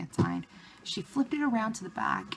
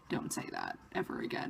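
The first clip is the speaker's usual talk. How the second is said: much lower, soft and stern like a strict father; stern dad; 7 dB quieter than usual. Retold or imitated. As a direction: scold her like a dad. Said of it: "such a dad look".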